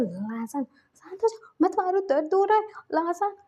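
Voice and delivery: high, loud pleading through pain; crying out in pain to stop the whipping. Acted. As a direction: beg him to stop the whip, voice cracking high. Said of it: "चीख रहा था, चिल्ला रहा था".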